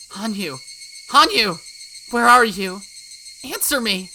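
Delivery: loud, high desperate calls into the dark; frantic calling. Acted. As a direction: yell her name to find her.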